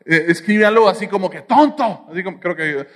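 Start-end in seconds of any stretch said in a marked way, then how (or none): 1.4-2.1 higher, punched insult; mock furious TONTO in capitals; mock angry shout. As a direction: snap it out like an all-caps text. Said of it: "así, mayúsculas".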